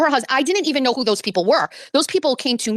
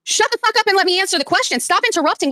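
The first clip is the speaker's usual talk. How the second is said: higher-pitched exasperated demand; angry interruption; 6 semitones higher than usual. Real, not acted. Host to heckling caller who keeps interrupting, angry. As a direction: firmly fight to get the floor back.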